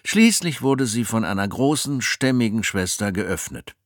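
usual speaking voice

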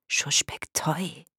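slow, secretive whisper; whispered reply. A narrator's reading. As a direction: whisper it slowly. Silvania antwortet beim Lauschen. (langsam geflüstert)

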